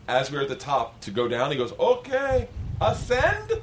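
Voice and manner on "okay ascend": high, loud, drawn-out call; non-English-speaking instructor. Retold or imitated. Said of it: "a guy named Zen who does not speak English".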